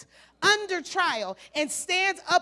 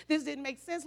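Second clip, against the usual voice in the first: ordinary talk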